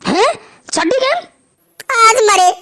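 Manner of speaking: high, breaking cry of fear; terrified. Acted. Father hearing the gang is here, high frightened wail. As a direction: wake up in sheer terror.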